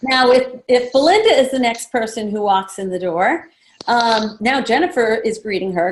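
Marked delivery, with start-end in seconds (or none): none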